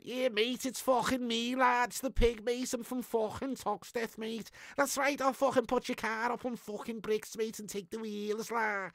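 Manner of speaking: high-pitched exaggerated Scouse; Scouse accent. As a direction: put on a hammed-up Scouse accent. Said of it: "Pig doing a Scouse accent".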